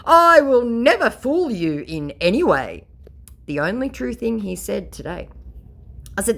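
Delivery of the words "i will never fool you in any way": higher, louder pompous scammer promise; mock scammer. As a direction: ham up the conman's oath. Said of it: "my scammer".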